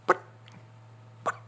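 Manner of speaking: short, isolated hen clucks; imitated hen cluck. Hunter recalls the hen he heard behind the house, imitated clucking. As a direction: cluck like a hen, one note at a time. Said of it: "she was clucking loud".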